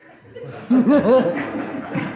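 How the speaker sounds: loud, high mocking laugh; hearty villain laugh. Rukmi mocks his sister, a loud scornful laugh.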